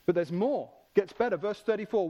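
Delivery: ordinary delivery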